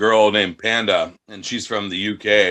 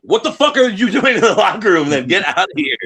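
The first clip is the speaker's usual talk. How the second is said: mock angry yell; 9 semitones higher, 3 dB louder than usual. Telling off girls in locker room.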